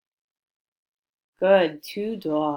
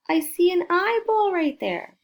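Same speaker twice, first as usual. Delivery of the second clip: higher and louder, thrilled at the egg; excited discovery; 12 semitones higher, 5 dB louder than usual. Real, not acted. Reading to children, spotting the eyeball, excited.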